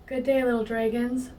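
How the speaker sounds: ordinary delivery, nothing marked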